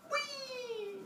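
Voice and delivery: very high, thin squeal; soft piping cry through the air; playful whee. Acted. Pregnant Leto diving to the island, squealing. Acting Leto's dive.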